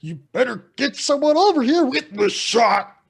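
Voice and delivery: put-on high, loud dinosaur demanding a child; loud Barney. Barney demanding a child to eat, high hammed-up shouting. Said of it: "Barney's like".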